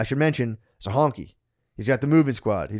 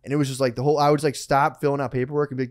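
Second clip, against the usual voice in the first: ordinary talk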